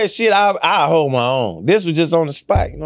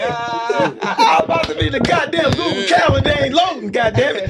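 laughing; 11 semitones higher than usual. Real, not acted. Laughing after being outplayed.

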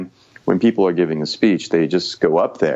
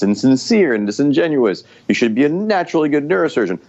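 mock-indignant, pitched up high; sarcastic; 7 semitones higher than usual. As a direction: scoff at it like it's absurd. Mocking the idea of trained skill.

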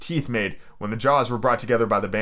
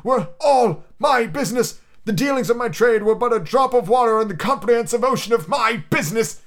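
ghost voice; 10 semitones higher, 4 dB louder than usual. Acted. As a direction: intone it like a chained spirit. Ghost laments.

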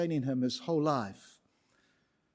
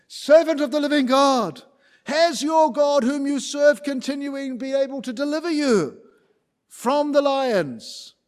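higher, louder anxious king, slowing at the end; an anxious king hoping against hope; King Darius pleading; 9 semitones higher, 7 dB louder than usual. Acted. Darius calling into the den at dawn, high and anxious. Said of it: "he says".

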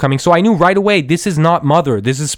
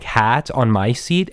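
ordinary talk, nothing marked

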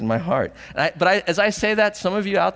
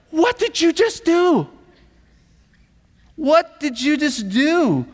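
high, loud scolding disbelief; shocked outrage; 13 semitones higher, 5 dB louder than usual. Acted. Onlookers confronting what just happened, shocked outrage. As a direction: demand it, high and aghast.

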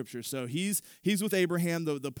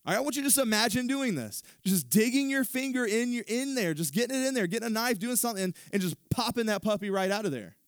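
animated; 7 semitones higher than usual. Real, not acted. Graphic imagining, raised animated voice.

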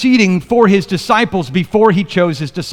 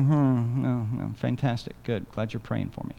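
a much softer, unimpressed mock thank-you; half-hearted thanks; 7 semitones lower, 12 dB quieter than usual. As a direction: shrug off the prayer as if it meant little. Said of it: "We're like".

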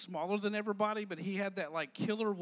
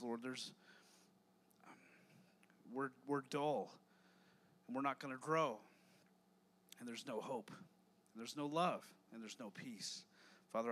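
soft, quiet, earnest prayer voice; 4 semitones lower, 6 dB quieter than usual. Acted. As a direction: pray it low and hushed before the youth group. Opening prayer before sermon.

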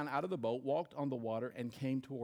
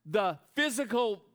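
loud, high preacher build; preaching; 10 semitones higher, 10 dB louder than usual. Acted. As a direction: preach it out, full force. Preaching at full force.

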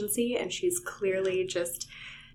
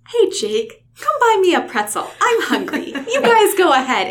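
higher, louder, bright and casual with a laugh; cheerful high Cassie voice tossing off an excuse; breezy cover story; 11 semitones higher, 11 dB louder than usual. Retold or imitated. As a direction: sell the fake excuse to get Jake away.

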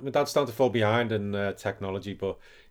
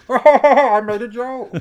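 very high, slow and louder, laughing through it; giggly pervy Yoda, pitched way up; 12 semitones higher, 10 dB louder, much slower than usual. Pretend. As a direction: Yoda cracking up at his own joke, dragged out. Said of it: "pervy part of pervy Yoda".

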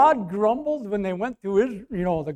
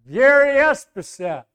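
high, raised-voice introduction; loud repeated name; 7 semitones higher, 7 dB louder than usual. Retold or imitated. Preacher repeating his name to the truck driver, loudly. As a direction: project the name so he catches it.

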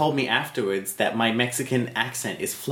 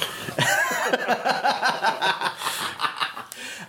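breathy laugh; 9 semitones higher than usual. Real, not acted. Laughs at own bit.

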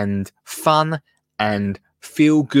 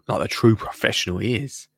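ordinary talk

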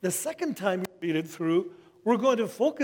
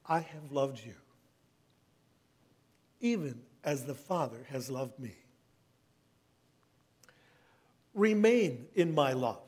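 hushed, reverent scripture reading; 3 semitones lower than usual. Acted. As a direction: read the words as Jesus' tender farewell. Reads Jesus' words aloud.

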